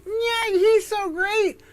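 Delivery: high, slow sarcastic gushing; mock admirer in a high voice; mocking praise. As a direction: gush it with fake awe, slowly. Mocking what everybody said.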